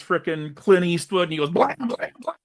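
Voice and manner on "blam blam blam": voiced gun sound effects; mock gunshots. Sheriff shooting into the water, mock gun sounds. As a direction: pop them out like cartoon gunfire.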